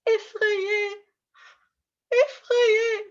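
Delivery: high, frightened little cry; hammed-up fearful squeal; acted scared. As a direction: act out being afraid, pitched well up. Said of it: "if I show you some different emotions".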